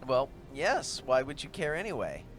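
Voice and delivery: usual speaking voice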